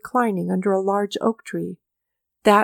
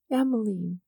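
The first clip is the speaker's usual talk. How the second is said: softer muttered name; quiet undertone murmur; 6 dB quieter than usual. A narrator's reading. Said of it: "she muttered in an undertone".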